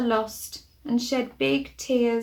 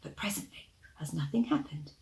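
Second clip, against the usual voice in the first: hushed whisper; 5 semitones lower, 6 dB quieter than usual. A narrator's reading. Hiding, hushed reading.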